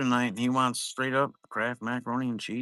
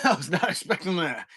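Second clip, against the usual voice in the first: laughing; 6 semitones higher than usual. Real, not acted. Telling chat about his son's dinner, laughing. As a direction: crack up while saying it.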